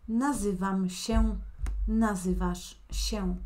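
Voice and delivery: both forms said slowly, the m and sh endings clear; slow teaching demo. Shown as a demonstration. Teacher reviewing the yo and tú forms, slowly. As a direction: contrast the two endings slowly. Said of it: "la conjugación del verbo".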